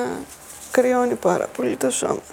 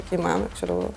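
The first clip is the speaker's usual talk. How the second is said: drowsy giving up; 7 dB quieter than usual. Retold or imitated. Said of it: "Λέω, «Τέλος»".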